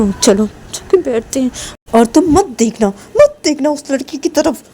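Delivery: high, forceful warning; heated girl laying down the law; jealous, threatening friend. A narrator's reading. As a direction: forbid it with an angry threat. Voices threatening Sneha.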